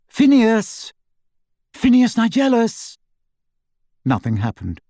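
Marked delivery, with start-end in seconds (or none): 0.1-3.0 high, lilting summons; calling out. A narrator's reading. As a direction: call to someone out of sight. Calling a portrait's occupant.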